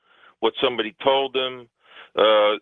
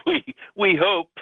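higher-pitched, laughing through the words; 9 semitones higher than usual. Real, not acted. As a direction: chuckle as you say it. Chuckles while replying to host.

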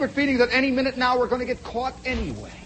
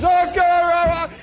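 crying wild wail; 9 semitones higher, 6 dB louder than usual. Retold or imitated. Crying sergeant swinging at private, loud hammed-up wail. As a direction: imitate a man sobbing and swinging wildly.

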